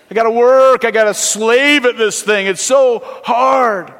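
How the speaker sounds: overworked believer moaning loudly; mock whining churchgoer. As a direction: act out a churchgoer groaning at the work of faith. Mimicking a weary churchgoer.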